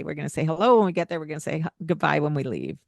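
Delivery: rattled off fast; brisk reminder. Shown as a demonstration. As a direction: zip through the plan quickly. Pre-paving instructions to kids.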